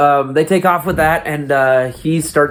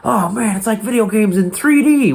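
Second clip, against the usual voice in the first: excited quote; 8 semitones higher than usual. Retold or imitated. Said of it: "they're like".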